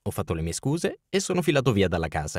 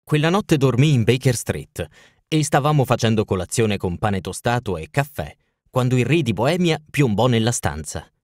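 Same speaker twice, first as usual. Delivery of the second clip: plain reading voice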